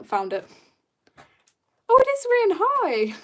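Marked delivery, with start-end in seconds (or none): 1.9-3.1 very high, loud delighted hello; real burst of excited recognition; excited greeting. Real, not acted. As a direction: squeal a happy welcome to a friend. Greets an arriving viewer.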